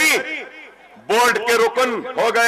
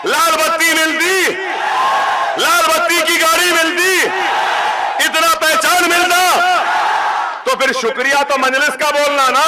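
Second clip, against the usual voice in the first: rally shout; 5 semitones higher than usual. Real, not acted. Rally speech, rising rhetorical demand.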